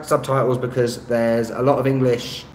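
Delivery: ordinary delivery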